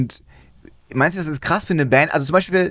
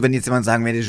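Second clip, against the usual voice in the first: ordinary delivery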